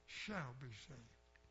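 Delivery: "shall be saved" drawn out slow and soft, leaning on "shall"; slow right down and weigh "shall" as a sure promise; a quiet, deliberate "shall be saved"; stress on shall. Shown as a demonstration. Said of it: "not may, could be".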